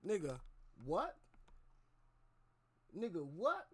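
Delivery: loud disbelieving what, pitch rising; stunned what. Real, not acted. Streamer reacting loudly to the clip, shocked disbelief. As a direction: shout it out in shock at what she said.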